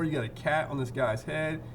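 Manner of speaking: ordinary delivery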